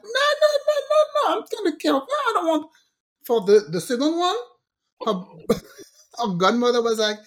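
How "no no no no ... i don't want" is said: high, insistent no no no; a mom's stubborn mock refusal. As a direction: wave off the help firmly. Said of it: "I'm like".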